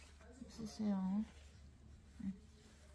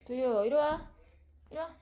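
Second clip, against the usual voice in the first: calling out; 7 semitones higher, 9 dB louder, much faster than usual. Real, not acted. Calling dog to come.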